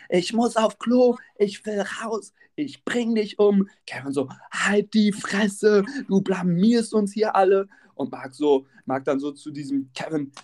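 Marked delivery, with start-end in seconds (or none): 0.1-3.7 high, drawn-out mock yell; Jacqueline screaming. Woman yelling to be let out, mock screaming. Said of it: "Jacqueline schreit rum". 4.5-7.7 higher, drawn-out mock fury; imitated angry retort. Embarrassed man silencing his partner, mock angry.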